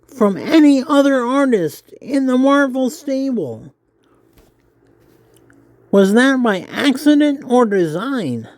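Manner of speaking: high nerdy interviewer voice. Acted. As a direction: pitch it up, eager and nerdy. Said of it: "I hope my nerdy voice isn't too nerdy".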